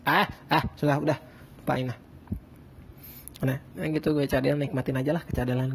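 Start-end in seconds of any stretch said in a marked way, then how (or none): none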